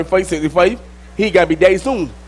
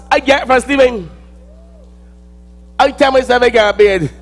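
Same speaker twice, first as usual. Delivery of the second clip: ordinary delivery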